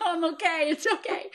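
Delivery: high, laughing imitation of a calm daughter-in-law; laughing mock reassurance. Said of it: "my daughter-in-law says".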